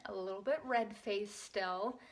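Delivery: normal talking voice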